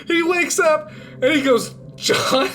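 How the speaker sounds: high-pitched, barely holding back laughter; real suppressed giggles; losing it laughing. Real, not acted. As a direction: tell it on the edge of cracking up. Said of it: "I'm losing it".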